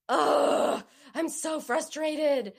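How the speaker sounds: loud groan into a high, drawn-out complaint; exasperated. Retold or imitated. Wife venting to her husband after a phone ordeal, exasperated. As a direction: vent frustration, stretching the words. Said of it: "I was going to either cry or scream".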